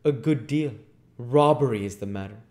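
loud, emphatic accusation; accusing doctor. Acted. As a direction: throw the charge at him forcefully. Doctor collars man, accusing him.

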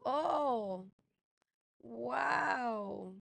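un "oh" y un "wow" muy alargados; asombro actuado. Retold or imitated. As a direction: alarga cada palabra con asombro fingido. Reacción actuada ante la foto. (asombro alargado)

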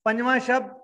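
usual speaking voice

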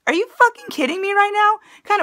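high, loud incredulous outburst; real outrage, pitch jumping up; outraged disbelief. Real, not acted. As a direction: blurt it out in furious disbelief. Outraged at the senator's speech.

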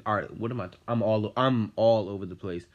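usual speaking voice

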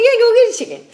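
high, loud mock doctor; cheery hammed-up order; doctor insisting on a chicken, high and loud. Doctor voiced in retold story.

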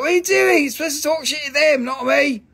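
high, loud mock scolding; scolding wife. Seller mimics his wife telling him off, mock indignation. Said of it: "She's like".

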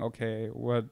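ordinary talk

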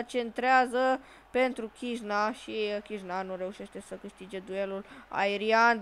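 ordinary talk, nothing marked

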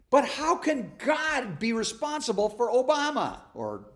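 incredulous question. Retold or imitated. Voicing a sceptic's question.

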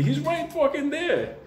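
high, slow, insistent retold yell; exasperated yell. Insisting to fireman.

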